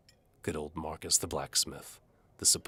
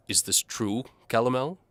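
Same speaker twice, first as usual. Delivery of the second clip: raised, louder questioning noble; indignant nobleman; 9 semitones higher, 9 dB louder than usual. A narrator's reading. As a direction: press the Duke for an answer.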